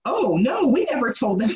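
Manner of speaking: mock innocent denial. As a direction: play an official protesting innocence. Mimics officials denying what they said.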